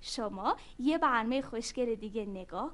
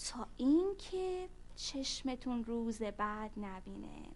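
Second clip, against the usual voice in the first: ordinary delivery, nothing marked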